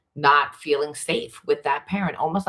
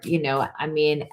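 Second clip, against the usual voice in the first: usual speaking voice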